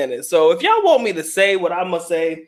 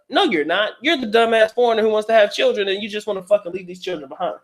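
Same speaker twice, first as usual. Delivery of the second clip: ordinary talk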